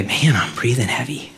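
whispered to himself, winded; a winded aside in a whisper; breathless whisper. Retold or imitated. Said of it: "I noticed right away".

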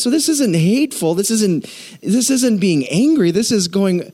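ordinary delivery

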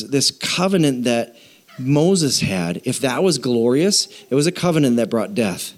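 usual speaking voice

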